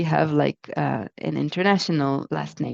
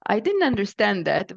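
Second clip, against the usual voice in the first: ordinary talk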